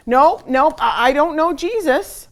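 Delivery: loud, high, flustered stammer; panicked denial. Acted. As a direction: blurt it out, caught and scared. Peter panics denying Jesus.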